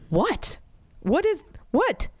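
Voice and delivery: high squeaky what, feigning surprise; mock shock. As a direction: play dumb in a high startled voice. Mother's shocked reply retold.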